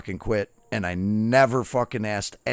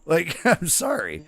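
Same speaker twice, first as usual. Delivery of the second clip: laughing; 5 semitones higher, slower than usual. Real, not acted. Laughing apology while retelling.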